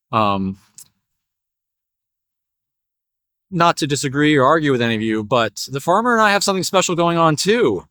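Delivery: low, apologetic horse, rising at the end; hesitant horse. Acted. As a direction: butt in politely as the horse. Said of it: "and the horse is like".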